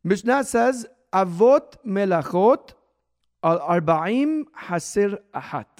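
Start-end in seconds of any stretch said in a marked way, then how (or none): 1.1-5.7 slow, loud Hebrew recitation; deliberate quotation for a class to follow; reading in Hebrew. Real, not acted. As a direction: read the source out word by word, slowly and firmly. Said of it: "I'll read it in Hebrew".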